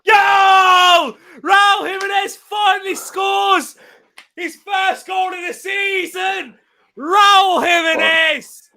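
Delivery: high, drawn-out goal scream; long goal yell. Real, not acted. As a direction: roar the goal like a commentator. Goal scored during live watchalong.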